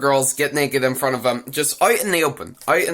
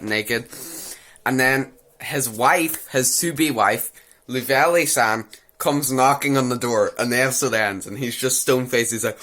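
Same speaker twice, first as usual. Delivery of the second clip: usual speaking voice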